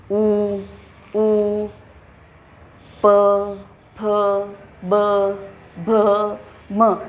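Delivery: each letter sounded out with pauses; lip sounds demo. Shown as a demonstration. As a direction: say उ ऊ प फ ब भ म from the lips. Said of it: "देखिए आप उच्चारण करिए".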